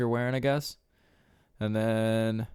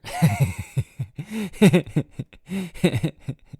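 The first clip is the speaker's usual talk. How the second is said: loud, high burst of laughter; long, helpless laughing fit; big laugh; 7 semitones higher, 7 dB louder than usual. Real, not acted. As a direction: crack up at your own story. Laughs at his own story.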